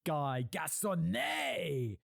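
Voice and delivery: French accent. Shown as a demonstration. Presenter demonstrating his method on a name, overblown French accent. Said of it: "just throw that on a name".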